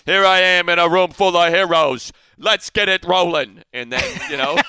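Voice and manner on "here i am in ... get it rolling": loud, high, slow announcer-style read; Hanzus impression. Co-host mocks a colleague with a slow, booming announcer impression. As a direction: boom it out like a grand host rallying heroes. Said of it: "Hanzus has got that".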